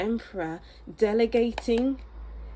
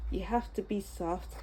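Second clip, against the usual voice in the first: much softer, gentle; 9 dB quieter than usual. Real, not acted. Reader telling viewer to be soft, hushed. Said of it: "talking softly from now on".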